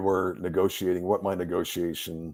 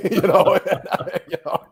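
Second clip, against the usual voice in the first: laughing; 10 semitones higher, 7 dB louder than usual. Real, not acted.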